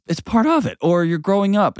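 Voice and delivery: ordinary talk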